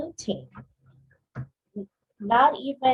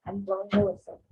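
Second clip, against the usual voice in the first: usual speaking voice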